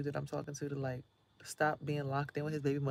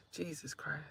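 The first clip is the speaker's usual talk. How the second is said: whisper; 7 dB quieter, slower than usual. Real, not acted. Relief after long episode.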